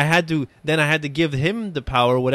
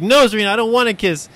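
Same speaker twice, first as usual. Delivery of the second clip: put-on high, loud whiny kid; high, loud mock child protest; child's whiny protest; 8 semitones higher, 6 dB louder than usual. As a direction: whine it like an upset little boy. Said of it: "he's like".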